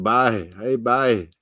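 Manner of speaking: put-on broad Newfie "boy" said as "bye"; playful Irish-tinged Newfie accent; Newfoundland accent. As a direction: imitate a friendly Newfoundlander's lilt. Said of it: "They say it".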